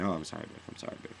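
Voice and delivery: mock apology, sinking very quiet; imitated release, trailing into softness; dropping back down. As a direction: come down from the outburst, fading away. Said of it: "you know, go down".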